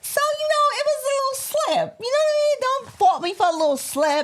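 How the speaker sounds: squeaky excuse. Pretend. Playful excuse in high voice.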